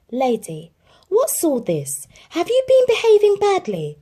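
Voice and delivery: rising to high, loud rebuke; scolding owner. Acted. Jim scolds Lady for behaving badly. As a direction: build up a stern scolding.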